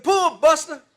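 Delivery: loud high call; calling out to the mule. Retold or imitated. Old farmer shouting to his mule to pull the car, loud call. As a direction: shout the command across the field.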